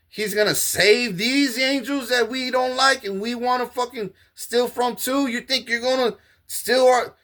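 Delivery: high, far-off mocking voice of the nurses; high scornful staff voice.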